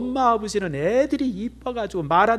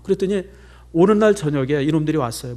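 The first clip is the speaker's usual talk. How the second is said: ordinary delivery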